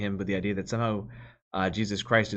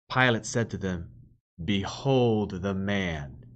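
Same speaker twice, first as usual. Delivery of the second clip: louder, measured reading of the Gospel; scripture reading; 2 semitones higher, 4 dB louder than usual. Acted. Preacher reads the Passion to the congregation, solemn proclamation.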